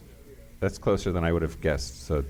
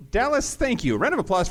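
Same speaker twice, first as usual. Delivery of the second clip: high excited thanks; 14 semitones higher than usual. Real, not acted. Host thanks crowd.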